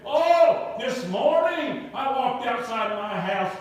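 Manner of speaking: a burst of excitement on the oh, rising high; retelling a friend's thrilled phone call; excited. Retold or imitated. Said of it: "he said with an excited voice".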